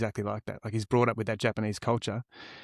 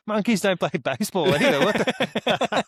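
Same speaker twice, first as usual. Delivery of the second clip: laughing in disbelief; 7 semitones higher, 4 dB louder than usual. Real, not acted. Friend reacts to banana story.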